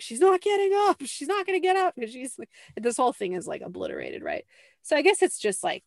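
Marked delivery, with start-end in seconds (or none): 0.0-1.9 mock bystanders. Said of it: "And they're like".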